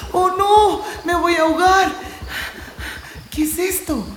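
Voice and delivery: about to drown, crying out; fear of drowning. Acted. Core afraid of drowning, high frightened cry.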